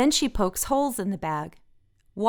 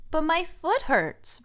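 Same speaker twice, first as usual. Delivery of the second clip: high, slow whiny complaint; Francine complaining; 8 semitones higher than usual. A narrator's reading. Francine complaining to Olivia on the mountain, high and slow. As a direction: whine it slowly, sore foot. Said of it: "Francine says".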